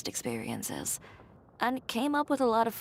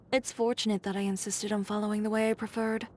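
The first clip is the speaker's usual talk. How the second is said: ordinary delivery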